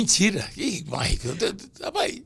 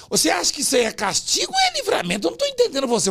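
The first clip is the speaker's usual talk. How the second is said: higher, puzzled imitation of the interviewer; mock confused interviewer; 8 semitones higher, faster than usual. Retelling how the reporter got confused, in a higher mock voice. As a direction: play the baffled reporter, pitched up. Ficou enrolado.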